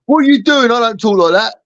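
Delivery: put-on high protesting voice; Lila. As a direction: mimic her objecting, pitched well up. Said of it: "this is how, how she sounds".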